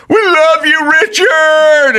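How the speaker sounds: high loud yell across the gym. Retold or imitated. Student in the gym shouting love at Richard, loud yell. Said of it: "someone just went up and just yelled".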